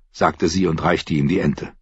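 ordinary delivery, nothing marked

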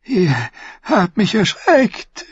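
Varys gasps high and startled; gasped. Acted. Varys' hohe Stimme. (gekeucht, hoch und erschrocken)

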